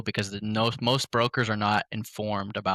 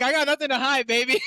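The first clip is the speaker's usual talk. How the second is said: high-pitched, giddy self-quote; laughing retelling. Retold or imitated. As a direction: retell it while cracking up. Cracking up over own sales line.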